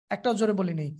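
ordinary talk

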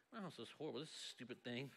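low, hushed mock self-pity; dejected grumble. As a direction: grumble under your breath about your lot. Voicing a deflated envious viewer.

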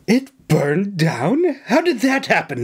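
shocked questions climbing high; gasping. A narrator's reading. Governor hearing of the burned hut, gasping. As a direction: gasp it as a horrified governor. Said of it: "he gasped".

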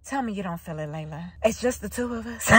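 low, soft mock-intimate plea; tender imitation. Man reassuring Layla it's just them, softly imitated. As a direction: imitate a man speaking softly to his love.

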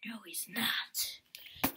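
slow sneaky whisper. Real, not acted. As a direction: breathe it out slowly. Sneaking in the dark.

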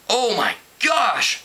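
high, slow shriek of alarm. A narrator's reading. Screech shrieking as he realizes they're spies. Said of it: "Screech shrieked".

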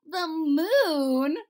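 loud, high astonished question; exclaimed. Acted. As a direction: gasp it out in disbelief. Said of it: "exclaimed the Lord High Chamberlain".

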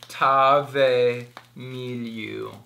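"Ajtave Miliu" in slow, separated syllables; careful, halting attempt at "Ajtave Miliu"; tentative foreign pronunciation. Shown as a demonstration. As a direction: sound out "Ajtave Miliu" very slowly, piece by piece. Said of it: "I don't know how to pronounce".

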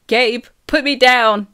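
indignant shrieked demand. A narrator's reading. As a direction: shriek it at someone who won't let go. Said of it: "I shriek".

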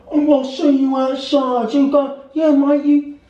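high-pitched mock hooligan threat; mock football fan. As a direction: taunt like a cartoon football thug. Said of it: "football fans, they go".

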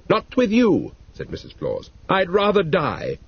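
defiant wife. Acted.

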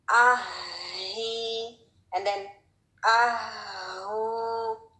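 आही, आहू drawn out with an airy ह; long, breathy ह in each; strong ha drill. Shown as a demonstration. Teacher drills long breathy आही and आहू. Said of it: "इस तरह से".

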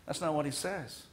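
ordinary talk, nothing marked

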